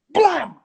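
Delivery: loud sharp slap sound effect; mock slap noise. Retelling his mum slapping the teacher. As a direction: bang the slap out. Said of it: "She went".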